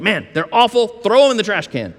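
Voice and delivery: lightly voiced callous dismissal; dismissive scorn. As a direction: toss them off like garbage. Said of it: "He's not just standing there going".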